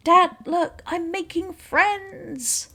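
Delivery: put-on high-pitched, drawn-out girlish call; excited daughter. Voicing daughter calling out to dad.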